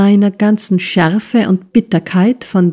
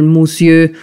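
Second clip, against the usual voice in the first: Monsieur sneered with a put-on French accent, musjø; a sneering French-accented Monsieur; mock French Monsieur. Uncle mocks his nephew, sneering Monsieur in French accent.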